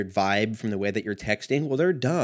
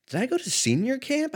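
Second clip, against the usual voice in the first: high, bewildered self-question; baffled question. Real, not acted. Vlogger wondering aloud about his own past, baffled high question. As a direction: ask yourself in disbelief. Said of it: "I didn't go to senior camp".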